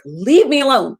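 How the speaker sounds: mock angry yell, leave me alone; retold angry outburst. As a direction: fling it out like a kid pushed too far. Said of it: "I just flipped the desk and I was just like".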